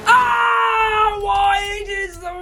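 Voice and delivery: high, slow, wailing shouts at himself; frustrated yelling. Real, not acted. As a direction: scream at your own terrible driving. Driving badly, yells at himself.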